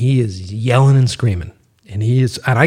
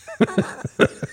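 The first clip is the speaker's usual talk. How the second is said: a burst of high-pitched laughter; 4 dB quieter than usual. Real, not acted. Laughs at own story.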